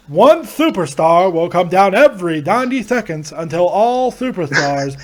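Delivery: swooping ring-announcer cadence; Howard Finkel. Acted. Announcer explains Royal Rumble rules, formal swooping cadence. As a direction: announce the rules with stretched, swelling words. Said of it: "when Howard Finkel was".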